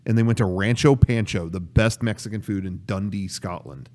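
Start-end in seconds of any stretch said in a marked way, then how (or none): none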